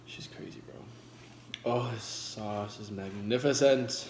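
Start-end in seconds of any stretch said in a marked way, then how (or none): 0.1-0.8 whispered aside. Real, not acted. Vlogger whispers to viewers about her crazy dog. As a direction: hush it to the camera.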